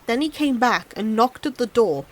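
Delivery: ordinary delivery, nothing marked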